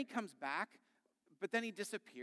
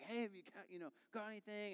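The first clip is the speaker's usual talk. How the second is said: soft, higher kid imitation; curious child; 4 semitones higher, 7 dB quieter, faster than usual. Child to a man fishing, softly mimicked curiosity. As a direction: lightly imitate a child asking, small voice.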